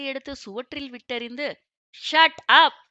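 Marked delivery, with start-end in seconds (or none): 1.9-2.8 sudden very loud, higher-pitched yell; explosive angry outburst; furious shout. A narrator's reading. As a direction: shout them down in anger. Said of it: "Kutch மூச்சென்று கத்திக் கொண்டிருக்க".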